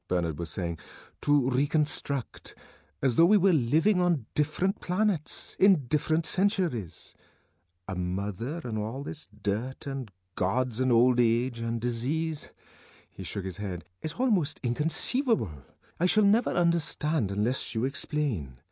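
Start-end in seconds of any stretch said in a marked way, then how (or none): 1.2-12.5 higher, earnest character voice; puzzled dialogue, lifted above narration; lightly voiced Bernard, baffled. A narrator's reading. 14.0-18.6 higher-pitched, insistent character voice; bewildered, raised-pitch dialogue; baffled Bernard. A narrator's reading.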